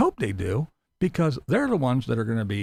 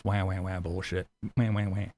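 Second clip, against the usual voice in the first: mock crying wah; 7 semitones lower, 5 dB quieter than usual.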